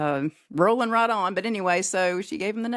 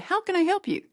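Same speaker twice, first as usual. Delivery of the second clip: high, polite operator greeting; phone operator; 13 semitones higher than usual. Retold or imitated. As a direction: put on the operator's bright phone manner. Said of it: "answered the phone and said".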